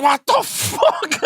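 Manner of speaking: drawn-out, very high shocked yell; shocked shout. Retold or imitated. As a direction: blurt it out slow and shrill as the kick lands. Startled dad shouts at son.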